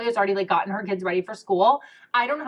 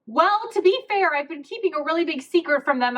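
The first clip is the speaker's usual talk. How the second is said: blurted confession; 7 semitones higher, 4 dB louder than usual. Retold or imitated. Customer admitting a big secret to cashier, blurting it brightly. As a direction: re-enact an over-candid confession to a stranger.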